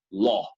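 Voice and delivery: LAW on a single open O; flat dry O. Shown as a demonstration. Teacher sums up for students, LAW with a dry O. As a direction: cut the U glide from LAW. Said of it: "una O seca".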